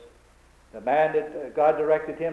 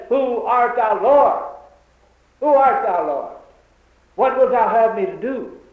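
Saul's awed question; 6 semitones higher, 5 dB louder than usual. Acted. Acts Saul answering the voice.

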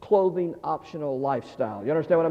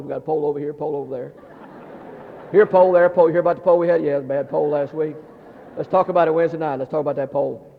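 low monotone gossip parody; grumbling churchgoers; 4 semitones lower, 4 dB louder, faster than usual. Retold or imitated. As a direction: drone on about problems, mock-solemn. Churchgoers dwelling on problems, parodied.

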